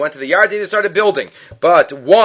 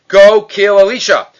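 barked order, raised; loud command; 4 semitones higher, 7 dB louder than usual. Retold or imitated. King ordering men to kill Elisha, loud command. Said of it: "he commands".

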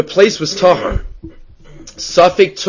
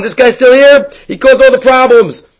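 higher-pitched, mildly hammed-up indignation; indignant outcry; 8 semitones higher, 4 dB louder than usual. Rabbi acting out spotting his old companion still alive, indignant. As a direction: raise the pitch in acted disbelief.

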